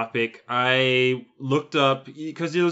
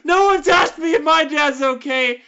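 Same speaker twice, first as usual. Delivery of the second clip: high, loud furious rant; angry yelling; 12 semitones higher, 3 dB louder than usual. Real, not acted. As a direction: shout out the grievance. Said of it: "I know I'm yelling and I'm angry".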